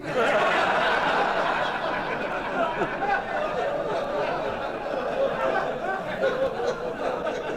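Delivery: long, high, breathless real laughter. Real, not acted. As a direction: laugh hard at the punchline. Laughs after punchline.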